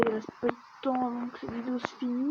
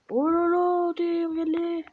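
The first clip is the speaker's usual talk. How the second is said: high, loud burst of excitement; excited exclamation; 5 semitones higher, 4 dB louder, slower than usual. Real, not acted. Gamer telling viewers to look, high excited cry. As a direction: call out excitedly, pitched right up.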